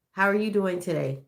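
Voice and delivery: usual speaking voice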